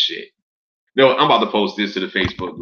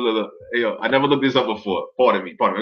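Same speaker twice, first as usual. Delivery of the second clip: normal talking voice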